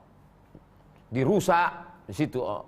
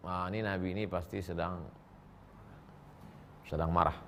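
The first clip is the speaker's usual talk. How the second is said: hushed aside; 8 semitones lower, 9 dB quieter than usual. Retold or imitated. Companions quietly noting the Prophet is angry, hushed. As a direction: lower and softer, murmured guess.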